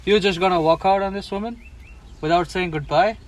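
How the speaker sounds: usual speaking voice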